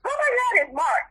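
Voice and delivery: mother's voice jumping high with joy at her grandson; retelling grandma's delighted gasp; mom's excited cry. Retold or imitated. Mother hears her grandson on the phone, high joyful exclaiming. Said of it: "She was like".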